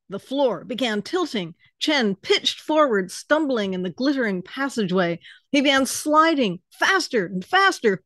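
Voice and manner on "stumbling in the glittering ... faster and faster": plain reading voice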